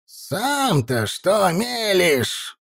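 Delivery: slow, high, creaky elder's retort; creaky old man shout. Acted. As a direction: crackle it out like an angry old man. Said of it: "громким скрипучим голосом выкрикнул Райну".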